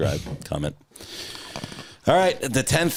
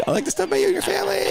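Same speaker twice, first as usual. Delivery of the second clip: much higher, fast, teasing; high mock voice; much faster than usual. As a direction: squeak it out teasingly.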